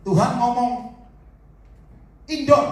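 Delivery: announcing God's word with force; loud declaration. Retold or imitated. Preacher to the asking father, a loud proclamation. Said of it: "Saya bilang".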